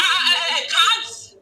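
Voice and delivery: ordinary talk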